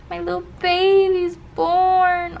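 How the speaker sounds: slow, moved, rising voice; emotional. Real, not acted. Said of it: "Oh, I'm emotional".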